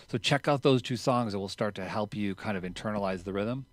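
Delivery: normal talking voice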